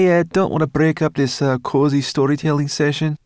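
gruff, loud, sarcastic brownie growl. Acted. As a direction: growl it with mock politeness. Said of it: "growled Sorrel".

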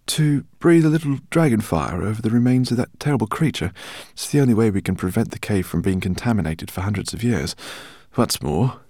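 put-on high, loud, fussy old scholar; eccentric professor. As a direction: voice him as an excitable professor. Professor speaks in story.

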